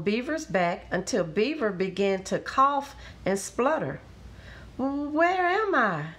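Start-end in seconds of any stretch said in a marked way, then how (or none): 4.8-6.1 high, slow, wobbling question; slow, bewildered, high-pitched waking voice; dazed coming round. A narrator's reading. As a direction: draw it out as if just coming to. Beaver comes round after near drowning.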